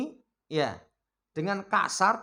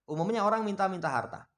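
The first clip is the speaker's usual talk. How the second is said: ordinary delivery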